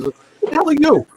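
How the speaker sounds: high, mock-angry demand laughing through it; hammed-up boss barking at a stranger; retold dealer snapping at a walk-on, half laughing; retold gruff challenge. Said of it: "Bill looks at him and says".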